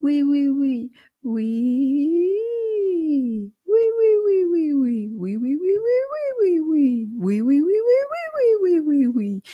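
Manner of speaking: timid oui gliding high and back down; whining glides. Shown as a demonstration. Coach demonstrating gentle whining glides on oui. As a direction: slide the shy whine up and down. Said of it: "tu peux faire des petites glissades".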